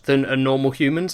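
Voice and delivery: ordinary delivery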